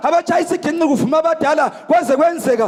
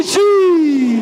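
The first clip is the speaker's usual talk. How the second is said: sharp praise cry; 3 dB louder than usual. Real, not acted.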